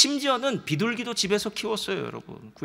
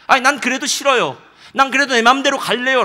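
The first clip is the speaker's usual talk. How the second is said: defiant refusal; 5 semitones higher, 9 dB louder than usual. Retold or imitated. Stubborn listener voiced.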